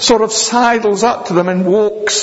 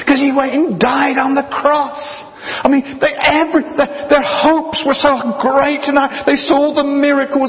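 preaching at full force; 10 semitones higher than usual. Acted. Preacher to congregation about lost vision, fervent preaching. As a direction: lift the congregation with it, ringing high.

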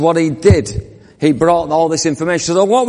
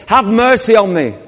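shouting; 5 semitones higher than usual. Acted. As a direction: shout over the crowd for mercy. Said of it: "he shouted all the more".

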